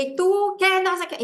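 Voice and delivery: high, snapping scold; mock scolding. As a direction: throw the question at her. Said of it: "porque aparte le dije". (regaño agudo de burla)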